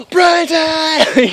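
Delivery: gasp, then a high, loud call; high, drawn-out yell of greeting; excited shout. Retold or imitated. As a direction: shout the name with overjoyed urgency. Runs to brother, calling his name.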